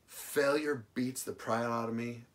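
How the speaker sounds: ordinary delivery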